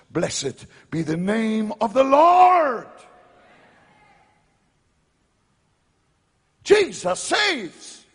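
loud, slow, high proclamation; preaching shout. Real, not acted. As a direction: cry it out to the crowd. Preacher exclaims praise.

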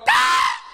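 very high-pitched "ah"; shocked exclamation. Real, not acted. Preacher objecting to timid pastor talk, high shocked cry.

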